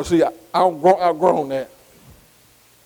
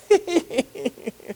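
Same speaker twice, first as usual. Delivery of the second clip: a sudden high laugh, quieter than his preaching; short laugh; 7 semitones higher, 5 dB quieter than usual. Acted. Preacher shows he laughs at the devil, mock laugh. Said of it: "I'm laughing".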